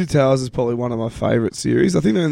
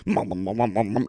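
mumbling; 5 semitones lower, 7 dB quieter than usual. Retold or imitated. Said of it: "and he's like".

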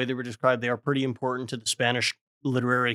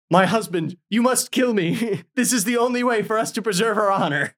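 melodramatic plea; 8 semitones higher than usual. Retold or imitated. Wife begging her husband to kill her, hammed-up melodrama. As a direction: play it like a soap opera heroine. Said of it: "she's like".

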